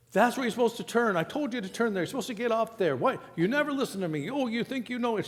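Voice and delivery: high, quick scolding mother; high-pitched mock nagging mom. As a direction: nag him like an anxious mom from the passenger seat. Mom scolding son while driving.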